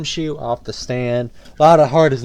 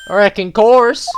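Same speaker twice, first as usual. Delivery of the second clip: Southern drawl; 6 semitones higher, 5 dB louder, slower than usual. Acted. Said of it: "And I'm Southern".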